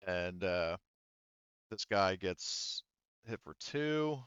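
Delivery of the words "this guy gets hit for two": ordinary talk, nothing marked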